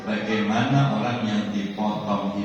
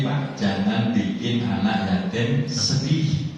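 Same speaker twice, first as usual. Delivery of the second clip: ordinary talk, nothing marked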